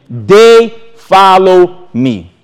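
they follow me, drawn out slowly; slow emphasis. Real, not acted. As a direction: stretch each word out. Verse said very slowly.

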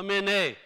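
"mene" ending in an ay sound; pronunciation demo. Shown as a demonstration. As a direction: say "mene" as min-AY. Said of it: "three ways this thing".

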